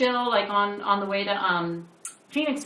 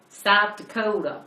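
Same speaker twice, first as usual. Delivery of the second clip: put-on slow, loud stab at a Southern accent; mock Southern accent; 3 semitones higher, 5 dB louder, slower than usual. Host imitating a man from South Dakota, mock Southern accent. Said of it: "don't think they speak Southern".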